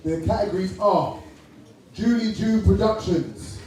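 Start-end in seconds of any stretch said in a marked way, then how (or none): none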